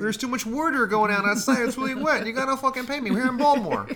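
high, loud hammed-up Baltimore cabbie; Baltimore accent. Imitating a Baltimore cab driver for laughs, Baltimore accent. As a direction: ham up a Baltimore cab driver demanding pay. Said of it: "like, a good, like, Baltimore accent".

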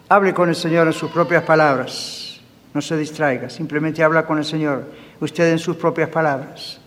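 quiet altar-call voice; gentle prayer invitation. Real, not acted. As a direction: ease them into praying alone.

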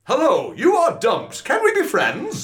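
very high, slow mountain voice; high silly voice. Acted. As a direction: play the Pyrenees squeakily. Pyrenees dumping volcano.

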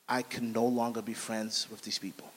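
low, quiet realization; hushed resolve. Retold or imitated. Man to himself on seeing his friends, hushed resolve.

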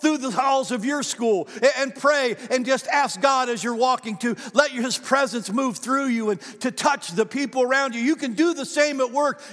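loud, high, ringing preacher's call to the congregation; full-force preaching. Acted. Pastor preaching hard to the congregation, loud and high. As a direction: preach it out big over the music.

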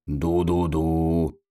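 low, slow horn imitation; deep drawn-out toot; mock steamboat cry. A narrator's reading. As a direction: hoot softly like a steamboat, drawing it out. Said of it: "и кричал он".

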